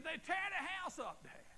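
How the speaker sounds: high, loud, exasperated daughter; daughter's loud complaint. Retold or imitated. Preacher quotes his daughter's complaint about her kids, high and loud. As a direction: cry out like the fed-up daughter.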